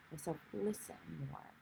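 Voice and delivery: hushed, soft self-talk; quiet. Acted. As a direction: sink into a near-whisper. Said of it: "quiet right now".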